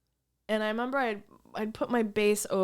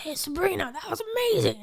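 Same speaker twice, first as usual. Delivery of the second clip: [name] impression; 8 semitones higher, faster than usual. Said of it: "he talked like this".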